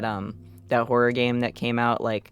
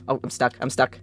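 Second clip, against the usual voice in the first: ordinary delivery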